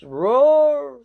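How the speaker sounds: loud mock roar, teeth on the page; playful dinosaur roar.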